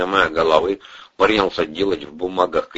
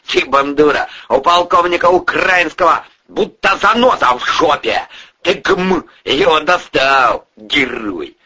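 raised, loud scolding; angry berating; 8 semitones higher, 5 dB louder than usual. Acted. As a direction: tear into a troublesome underling. Boss berates the young man.

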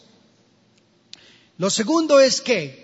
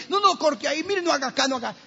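patient protesting surgery; 6 semitones higher, faster than usual. Retold or imitated.

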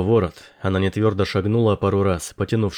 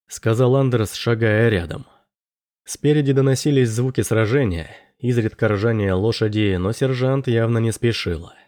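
usual speaking voice

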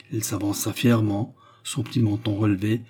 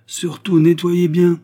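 high, loud, drawn-out jeer; slow mocking taunt, pitched up; sneering; 8 semitones higher, 9 dB louder, slower than usual. A narrator's reading. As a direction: gloat over someone made to clean. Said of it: "ricana l'aveugle".

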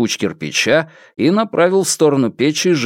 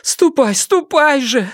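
high, anxious repeated command; agitated urging; slower than usual. A narrator's reading. Mother hurrying the fugitive away, high agitated voice. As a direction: shoo him away in a high, worried voice. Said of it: "волновалась матушка".

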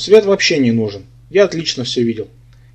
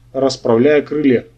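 plain reading voice

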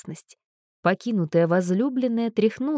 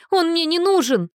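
high, loud tearful cry; protest; 10 semitones higher, 4 dB louder than usual. Acted. Girl cries out at Anna, high and loud. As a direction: shout it through tears.

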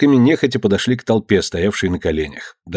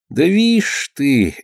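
slow, higher, reluctant stalling; 5 semitones higher, much slower than usual. A narrator's reading. Fedka evading his master's question, slow and reluctant. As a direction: drag the words out unwillingly. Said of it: "заговорил нехотя Федька".

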